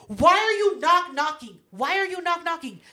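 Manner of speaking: exasperated rebuke. Acted. Scolding visitors who say it aloud, exasperated rebuke. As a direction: demand an answer, hammed-up and high. Acted rebuke of verbal door-knockers.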